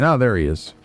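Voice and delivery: ordinary talk, nothing marked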